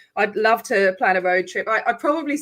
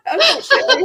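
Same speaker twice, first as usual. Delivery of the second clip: laughing through the word, high and louder; 5 dB louder, faster than usual. Real, not acted. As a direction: giggle it out, embarrassed. Laughing after admitting the accent.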